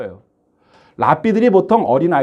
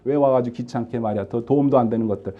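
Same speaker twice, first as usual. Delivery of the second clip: dismissive disciples; 6 semitones lower, 6 dB quieter than usual. Retold or imitated. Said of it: "제자들은 늘상 하던 대로".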